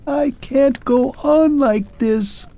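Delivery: thin strained mechanical squeak; shrill undertone. Acted. Clockwork man giving up before Arthur, shrill undertone. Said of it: "in a shrill undertone".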